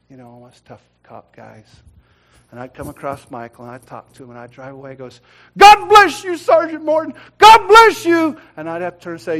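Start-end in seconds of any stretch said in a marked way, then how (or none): 5.6-8.4 high, loud holler after someone leaving; calling out. Retold or imitated. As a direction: imitate an eager street preacher yelling. Michael calls after departing car.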